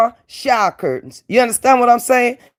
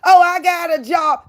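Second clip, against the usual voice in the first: mock bragging in a much higher voice; sneering high-pitched imitation; 10 semitones higher than usual. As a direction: mimic her smug boast, pitched way up. Mocking rival's bragging.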